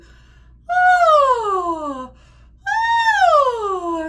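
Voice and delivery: loud high sigh sliding down; high sighing siren. Shown as a demonstration. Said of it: "get all the way up there".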